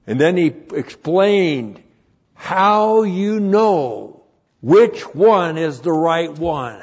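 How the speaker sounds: loud and high sermon delivery; rally the congregation; preaching at full force. Acted.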